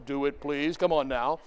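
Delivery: usual speaking voice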